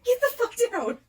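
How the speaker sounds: high, loud laughing shout at the cat; laughing yell. Real, not acted. Yelling at the cat to get down. Scolding cat off her leg.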